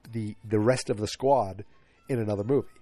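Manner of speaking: normal talking voice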